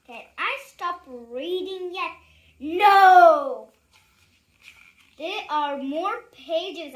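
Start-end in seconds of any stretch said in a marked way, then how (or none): 2.6-3.7 loud emphatic no. Acted. Book refusing the reader's plea, loud. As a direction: shout the no.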